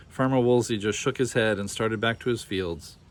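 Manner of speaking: ordinary delivery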